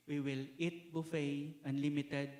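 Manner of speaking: normal talking voice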